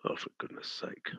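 exasperated, breathed under the breath; muttered exasperation. Real, not acted. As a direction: grumble it to yourself, almost silently. Frustrated at the screen, under breath.

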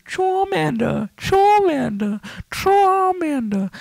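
very high stretched calling out the name; sing-song call. Acted. Gamer calling his pokemon in a squeaky high singsong. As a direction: call it high and slow like a cartoon cry.

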